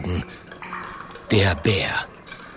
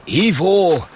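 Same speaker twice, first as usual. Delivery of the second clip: lightly voiced cocky caveman, higher and louder; dismissive caveman; 7 semitones higher, 5 dB louder than usual. Klonk brushes off Mathilda's worry, dismissive. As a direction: brush it off with a swooping call.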